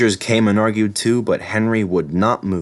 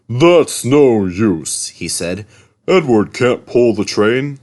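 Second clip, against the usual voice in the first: very slow, louder scornful; 4 dB louder, slower than usual. A narrator's reading. Gordon scorning Edward, slow sneer.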